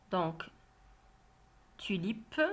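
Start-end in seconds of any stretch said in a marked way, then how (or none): none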